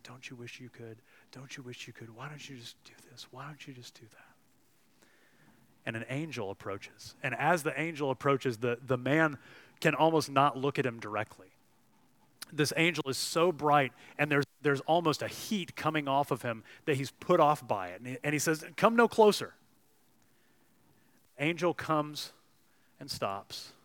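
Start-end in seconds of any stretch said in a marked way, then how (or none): 0.0-5.1 hushed, tempting whisper in his ear; whispering lizard. A narrator's reading. Lizard tempts the man, soft tempting whisper. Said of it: "this little red lizard is whispering in his ear". 18.8-19.5 high, loud, frightened cry to keep away; fearful plea. Acted. Ghost man fends off bright angel, frightened cry.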